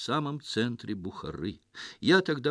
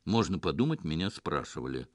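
mournful; 6 semitones lower than usual. A narrator's reading. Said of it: "скорбно ответил Оболенский".

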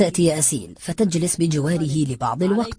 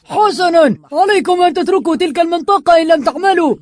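high, forceful yelled warning; angry shout; 12 semitones higher, 6 dB louder than usual. A narrator's reading. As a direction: lay down the law at full shout. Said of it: "فصاح يامن غاضبا".

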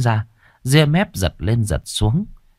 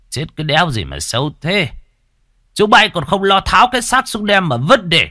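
raised, contemptuous village bully; callous scolding; 10 semitones higher than usual. Acted. Village chief orders the body cut down, callous high scolding. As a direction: scold underlings with rising contempt.

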